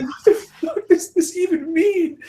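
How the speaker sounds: high, slow, laughing disbelief; incredulous disbelief. Retold or imitated. Retelling a bizarre DM to co-hosts, high laughing disbelief. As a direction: stretch it out in baffled laughter. Baffled by Xbox Mafia DM.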